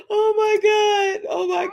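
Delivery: high shrieking laugh. Real, not acted. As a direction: scream it through laughter. Laughing scream.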